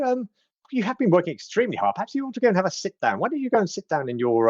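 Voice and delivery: high fast fussing granny voice; sweet old lady. Acted. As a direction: fuss over the old fellow in a high quick voice. Kettlestein disguised, soothing Burley.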